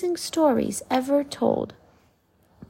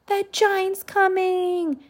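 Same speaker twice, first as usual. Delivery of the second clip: much higher, slow frightened cry; scared boy's scream; 10 semitones higher, slower than usual. Acted. A boy warns the townsfolk, high frightened scream. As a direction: cry out in panic. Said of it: "screamed a boy".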